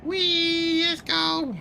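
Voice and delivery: playful whee. Pretend. Driving game, playful cheer.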